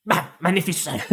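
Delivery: a fumbled attempt at magnificent in a French accent; a soft, failed French-accented magnificent; botched French accent. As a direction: try the word in a French accent and trip over it. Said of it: "trying to say magnificent in a French accent".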